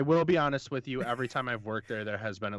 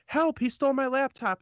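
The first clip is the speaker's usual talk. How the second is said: put-on high panicked girl; 5 dB louder than usual. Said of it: "the girl was like".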